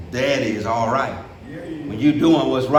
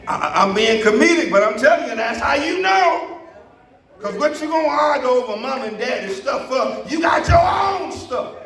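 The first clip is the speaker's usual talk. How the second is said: fired-up pulpit shout; preaching at full force; 10 semitones higher than usual. Acted. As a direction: preach it out to the whole church. Preacher climax after a joke.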